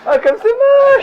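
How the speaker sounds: very high, slow squealed wail; mock lament. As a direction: stretch it out in a hammy squeal. Mock lament over lost teeth.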